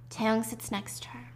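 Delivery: usual speaking voice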